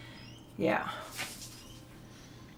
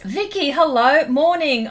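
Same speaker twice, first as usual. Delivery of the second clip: cheery greeting; 5 semitones higher, 8 dB louder than usual. Real, not acted. Greeting a chat viewer.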